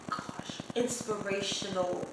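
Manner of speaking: long unvoiced sigh into a hushed word; sigh, then breathy reluctant word; heavy sigh. Real, not acted. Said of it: "Ugh, I hate that word".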